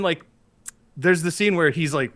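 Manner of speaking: normal talking voice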